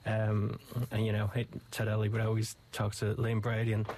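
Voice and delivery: normal talking voice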